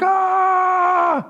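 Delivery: high mock scream of panicked disciples. Disciples in the storm, a high panicked cry. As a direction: squeal like a terrified sailor. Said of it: "they're like".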